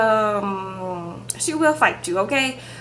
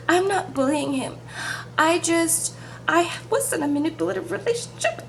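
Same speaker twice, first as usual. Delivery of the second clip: mildly upset protest, higher pitched; imitated upset; 6 semitones higher, 4 dB quieter than usual. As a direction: mimic someone getting worked up, lightly. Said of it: "Avery was getting upset".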